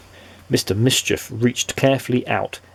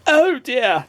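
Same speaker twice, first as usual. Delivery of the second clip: spluttering; 13 semitones higher than usual. A narrator's reading. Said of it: "spluttered Mister Mischief".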